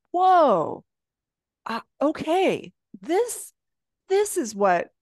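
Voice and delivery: loud, high whoa, then hesitant; startled realization. Retold or imitated. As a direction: act out being stopped short by a revelation. Retelling moment of sudden realization.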